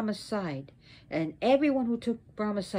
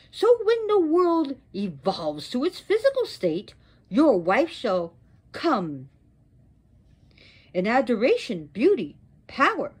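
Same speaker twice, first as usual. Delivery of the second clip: wrathful curse; 3 semitones higher, 4 dB louder than usual. Acted. Goddess sentences Vishnu to lose his wife, wrathful curse. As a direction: declaim the sentence with swooping pitch.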